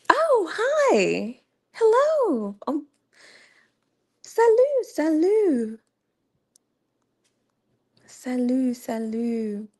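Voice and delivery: high, excited hellos to new viewers; excited high greeting. Real, not acted. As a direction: greet them like a long-lost friend. Greeting arrivals on stream.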